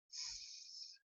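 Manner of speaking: "s" as a long, voiceless hiss; isolated s sound. Shown as a demonstration. Teacher picks out the second sound, "s" hissed alone. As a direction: sound out "s" alone, not the letter name. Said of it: "and you can hear".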